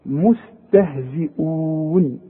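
مستهزئون recited slowly, every letter light; slow recitation of مستهزئون; thin letters. Shown as a demonstration. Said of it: "كلها مرققة".

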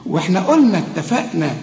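usual speaking voice

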